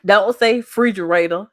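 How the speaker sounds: ordinary talk, nothing marked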